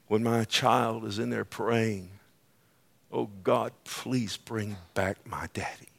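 very soft, low and slowing plea; tenderly quoted plea, almost under the breath; child's prayer retold. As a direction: read it hushed, fading into a small prayer. Reading a heartbroken parent's email.

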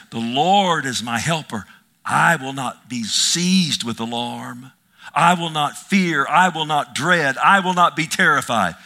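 loud, slow, hammering each "I will not"; bold preaching declaration. Acted. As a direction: declare it like a battle cry. Preacher declaring faith boldly.

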